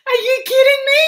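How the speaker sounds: high, loud, laughing disbelief at being cut off; incredulous. Retold or imitated. Retelling being cut off by the airline, laughing high disbelief. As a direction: squeal it out in laughing outrage. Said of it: "I'm like".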